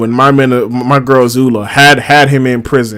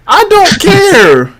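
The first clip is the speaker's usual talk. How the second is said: hyped Iroh impression; 11 semitones higher than usual. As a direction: yell it like a pumped-up old man hitting the gym. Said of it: "my man Iroh said".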